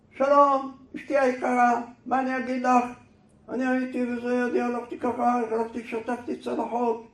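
loud, high monotone yell; shouted letter reading. Acted. Passer-by impatiently reads husband's letter to wife, shouting. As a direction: bellow the letter out like an angry rant. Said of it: "למה בעלי צועק עליי?".

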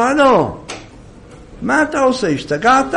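startled wife snapping at her husband; scolding wife. Acted. Wife scolding her husband who sits reading psalms.

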